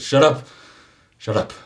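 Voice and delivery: sharp mock snap of shut up; sheriff snapping. Sheriff snaps at the babbling paramedic, mock angry. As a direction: bark it at the paramedic. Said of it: "Sheriff Nash turns to him and says".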